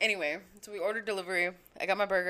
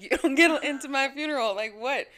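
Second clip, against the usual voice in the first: higher and louder, indignant; mock indignant; 6 semitones higher, 7 dB louder than usual. Telling mom no color allowed, higher and louder mock outrage. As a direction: act outraged at the idea. Retold funeral rule to mom.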